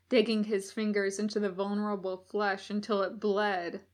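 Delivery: ordinary delivery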